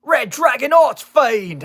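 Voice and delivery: big, drawn-out Cockney battle cry; over-the-top Cockney anime shout; Cockney Jack Atlas. Retold or imitated. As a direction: bellow it slow and high in hammed-up Cockney. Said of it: "the most Cockney-sounding motherfucker".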